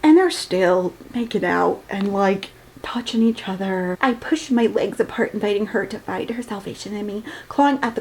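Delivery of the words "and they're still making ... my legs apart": ordinary talk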